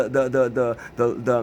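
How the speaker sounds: ordinary delivery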